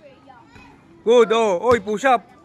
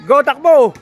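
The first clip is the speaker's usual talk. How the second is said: shouting; 3 semitones higher, 7 dB louder than usual. Real, not acted. Coach calling out drill commands.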